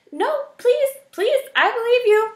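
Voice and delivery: very high, loud desperate plea; crying out in fear. A narrator's reading. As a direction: beg him to stop, panicked. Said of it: "Poppy cried".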